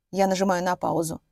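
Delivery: usual speaking voice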